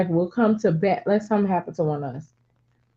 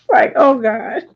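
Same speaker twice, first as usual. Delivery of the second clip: breathless laugh breaking through; 13 semitones higher, 5 dB louder, slower than usual. Real, not acted. Laughing at her son's warning to the girl. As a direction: laugh it out, can barely get the words.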